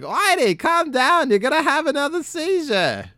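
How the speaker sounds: Eleanor voice. Retold or imitated. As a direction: fuss over him like an anxious old wife. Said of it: "And Eleanor's like".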